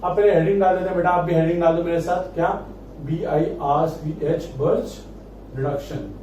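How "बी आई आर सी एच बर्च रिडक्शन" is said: measured dictation with gaps for writing; slow dictation. Real, not acted. Teacher has students write the heading along, slow dictation. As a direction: spell it out slowly, pausing between words.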